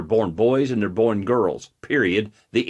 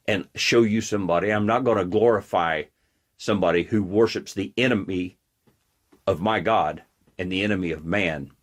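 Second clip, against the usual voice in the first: usual speaking voice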